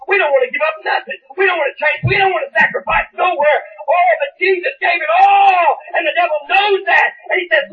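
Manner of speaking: ordinary talk, nothing marked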